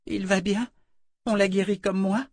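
put-on high childlike voice, upset; a frightened little girl telling what happened. Oli tells the Mother Confessor her fear, high child voice. Oli asks about Warren.

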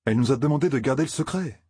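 quick, defensive reply, demandé stressed. A narrator's reading. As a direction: rush it out, justifying yourself. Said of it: "se défendit Rand". (se défendre vite, en insistant sur «demandé»)

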